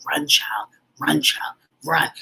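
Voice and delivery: hushed, urgent whisper of the ancestors; ancestral whisper. Retold or imitated. Ancestors urging her to flee, whispered. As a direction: breathe it like spirits in your ear. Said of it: "like the ancestors are in my ear".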